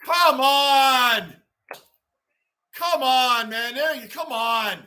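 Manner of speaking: exasperated groan at a goal allowed; frustrated come on. Real, not acted. Fan at the TV as the Rangers concede, exasperated. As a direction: fan yelling at the game on TV. Frustrated at his team's play.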